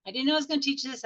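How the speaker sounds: ordinary delivery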